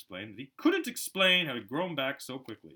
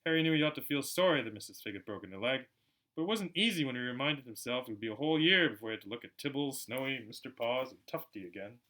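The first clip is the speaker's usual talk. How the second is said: ordinary delivery, nothing marked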